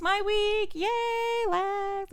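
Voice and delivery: high, drawn-out little cheer; playful self-cheer. Pretend. Host announcing it is her week, playful high cheer. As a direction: celebrate your own turn in a high-pitched voice. Mock cheer introducing own topic.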